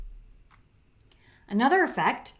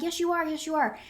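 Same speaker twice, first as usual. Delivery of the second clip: insistent retort; 7 semitones higher, faster than usual. Retold or imitated. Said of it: "I'm like".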